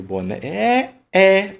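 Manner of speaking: "boné" with the final é held and repeated high and loud; stressed final syllable. Shown as a demonstration. Teacher points out the tonic syllable, final é of "boné" held loud and high. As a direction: punch out and stretch the é of "boné". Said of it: "sílaba tônica".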